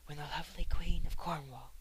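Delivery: ordinary delivery, nothing marked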